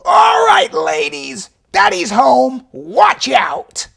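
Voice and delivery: swaggering shouted warning to inmates; tough guard shout. Retold or imitated. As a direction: announce yourself to the block like a boss. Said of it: "and he said".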